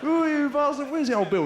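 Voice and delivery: high, loud mock threats; all bluster while hoping to be held back; hammed-up football thug; blustering hooligan. Hooligans blustering, waiting for police.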